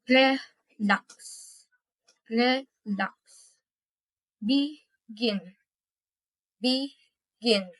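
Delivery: "relax" and "Begin" each split by a pause; syllable-by-syllable stress demo. Shown as a demonstration. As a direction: separate re-lax and be-gin into clear syllables. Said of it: "the second syllable is stress".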